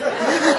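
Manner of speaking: sudden squeaky laugh; high burst of laughter. Real, not acted. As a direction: break into a giggle. Laughing at own story.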